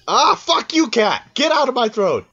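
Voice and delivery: loud, high-pitched mock rage; exasperated outburst. Real, not acted. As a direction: yell at a voice stuck in your throat. Mock rage at own cat voice.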